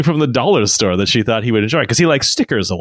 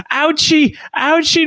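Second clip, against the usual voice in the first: put-on high, whimpering ouchie like a small hurt child; retelling his son's frantic ouchie in a much higher voice; imitating a crying toddler. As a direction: play the panicked little boy scratching his arm. Toddler panicking over a tattoo.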